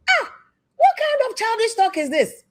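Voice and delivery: high, mocking voice with a far-off timbre; mocking high scold. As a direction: sneer it out in a high put-on voice.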